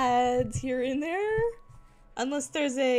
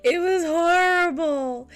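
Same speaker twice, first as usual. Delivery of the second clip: slow, louder, drawn-out complaint; dramatic whine; 6 semitones higher, 6 dB louder, slower than usual. Real, not acted. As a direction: stretch it out in mock despair. Complaining about audio pain.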